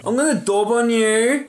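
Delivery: high, mock-childish taunt; ten-year-old tattler. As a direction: threaten to tell like a little kid. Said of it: "dobbed is like a ten-year-old word".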